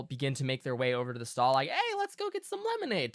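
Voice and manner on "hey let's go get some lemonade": high, quick, coaxing parent; parent to small child. Acted. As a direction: chirp it quickly to a little kid. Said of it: "talking to, like, their little tiny kid".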